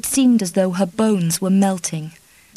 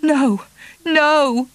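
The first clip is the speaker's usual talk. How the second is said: higher, louder protest; cried out; 8 semitones higher, 4 dB louder than usual. Acted. Kitty refusing Charlie's kiss, high cry. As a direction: cry out against him. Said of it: "she cried".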